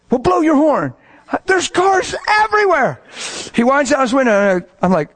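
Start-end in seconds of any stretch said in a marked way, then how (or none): 0.1-3.0 high mock shouting, climbing in pitch; exasperated protest. Driver to passenger amid jammed cars, exasperated. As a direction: act out a driver losing patience.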